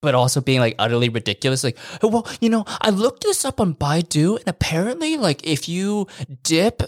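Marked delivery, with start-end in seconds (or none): 2.0-6.8 lightly voiced eager advice-giver; mock know-it-all neighbour. Mocking a neighbour's silly tip, high and eager.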